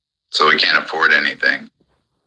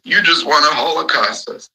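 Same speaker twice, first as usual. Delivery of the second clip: high-pitched mocking whine, much higher than his own voice; 11 semitones higher than usual. Ranting vlogger mimics people he argues with, high mocking whine. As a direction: parody the whiny complaint high up.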